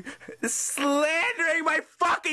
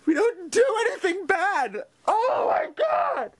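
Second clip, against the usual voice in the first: crying; 4 semitones higher than usual. Real, not acted. Man hurt by TV slander at Thanksgiving, crying. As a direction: cry out in disbelief, voice climbing to a wail. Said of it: "fucking crying right here".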